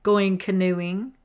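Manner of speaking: slow, loud, sneering question; mock her with a nasty smile; drawn-out taunt. A narrator's reading. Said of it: "she smiled nastily".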